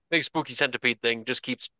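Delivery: normal talking voice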